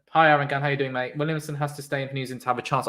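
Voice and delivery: normal talking voice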